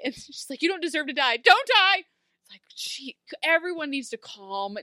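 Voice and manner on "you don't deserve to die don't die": rising into a high, loud mock shout; plead, then burst into an exaggerated cry; melodramatic plea. Friend begging Ruby not to die, hammed-up desperate shout.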